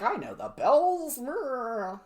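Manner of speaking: slow, high, drawn-out mock boast; goofy swaggering put-on voice trailing into a growl; mocking Andrew Jackson. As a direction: draw it out, mocking him. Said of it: "he's like".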